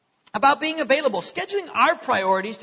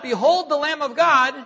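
raised, ringing call of a prophet; mock proclaiming yell; 5 semitones higher, 6 dB louder than usual. Preacher retells John crying out to the crowd, a mild proclaiming yell. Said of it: "But John yells out".

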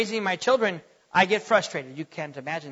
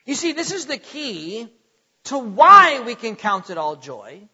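ordinary delivery

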